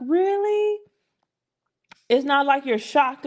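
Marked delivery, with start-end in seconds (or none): 0.1-0.8 high squeaky mock disbelief at a compliment; mock shock. Woman pretending to be stunned by a compliment, mock shocked. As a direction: play the shocked woman, pitch jumping up high.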